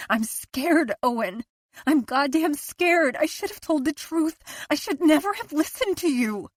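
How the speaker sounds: panicked wife near hysteria; scared, rising panic. A narrator's reading.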